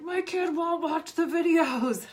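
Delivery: high, slow, whining complaint; slow, high, whiny parent voice; whiny mom mimic. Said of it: "the one mom who's like".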